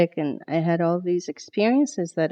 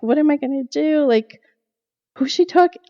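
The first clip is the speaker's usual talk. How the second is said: panicked thoughts; 8 semitones higher, 4 dB louder than usual. Retold or imitated.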